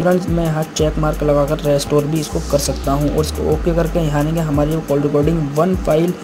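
ordinary delivery

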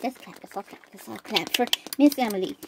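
usual speaking voice

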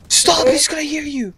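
soft, urgent warning; hushed pleading. Real, not acted. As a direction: plead under the breath. Hushed repeat so dad won't hear.

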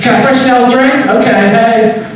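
high, chirpy southern waitress; nice waitress. Retold or imitated. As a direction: switch to sweet, high-pitched service voice. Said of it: "real nice waitress".

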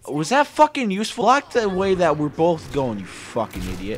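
loud, high scolding shout; furious gamer rant; angry yell. Real, not acted. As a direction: bawl out a teammate. Yelling at teammate.

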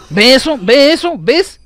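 high, very loud repeated questions; outraged shouting. Real, not acted. Gamer protesting being killed, outraged high shouting. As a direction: yell it in exasperated disbelief.

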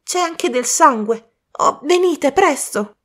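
a worried youth bursting in; young man Colin. Acted.

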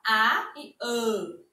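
A closed like â, then open like á, slow; slow vowel contrast â and á. Shown as a demonstration. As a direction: contrast â and á, slowly. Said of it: "O sonzinho mais fechado e o sonzinho mais aberto".

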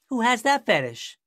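high, loud, incredulous comeback; mock the question at full volume; smart-aleck retort. Young driver mouthing off to the officer, smart-aleck. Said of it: "used to being a smart aleck".

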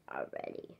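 whispered aside. Real, not acted.

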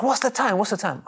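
urgent question. Retold or imitated. Friend urgently asks the time.

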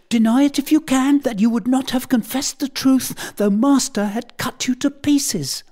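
put-on a crying child pleading his case; sobbing boy. A narrator's reading. Blifil wails through tears against Tom to the tutor. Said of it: "Here he burst into a flood of tears".

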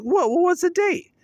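high, loud surprised questions; mock-startled squeak; retold startled mom. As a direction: imitate mom caught off guard, pitch leaping up. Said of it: "Well, she's like".

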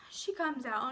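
normal talking voice

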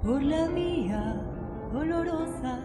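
usual speaking voice

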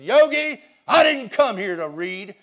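triumphant comeback. Retold or imitated. Hank answers Yogi after home run.

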